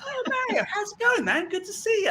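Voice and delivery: very high gushing greeting to a celebrity; fake delighted greeting. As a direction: greet him like an old friend, overjoyed. Acting as if she knew him.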